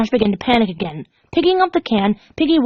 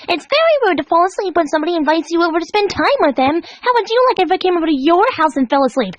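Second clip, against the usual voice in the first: frustrated lashing out; 9 semitones higher than usual. Acted. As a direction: scold her like a spoiled child. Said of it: "The frustrated Pinkie lashed out".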